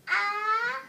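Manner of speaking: loud, high, rising "ah"; pre-sneeze gasp. Acted. Gerald about to sneeze on his friend, pre-sneeze gasp.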